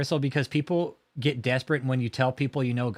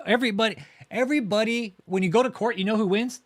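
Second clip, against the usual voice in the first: worked-up rant; 9 semitones higher than usual. Real, not acted. Ranting to chat about who wins in court, worked up. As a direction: build the rant up to the punchline.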